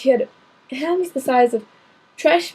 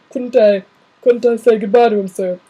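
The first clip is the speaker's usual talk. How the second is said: the giant pleading, booming; corrected to the big gamekeeper's voice; Hagrid voice; 7 dB louder than usual. Acted. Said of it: "said Ha-, er".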